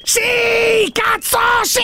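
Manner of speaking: high, stretched triumphant shouting; exultant yell. Acted. Host confirming he built the rocket, exultant. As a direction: yell your yes with manic pride. Insists once more.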